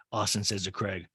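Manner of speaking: ordinary talk, nothing marked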